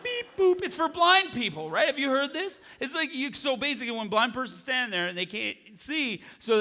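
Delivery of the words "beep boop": high beep-boop electronic tones; crosswalk beeps. Retold or imitated. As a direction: chirp like a crosswalk signal. Crosswalk signal.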